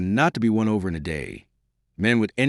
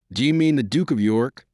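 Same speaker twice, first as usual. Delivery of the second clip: plain reading voice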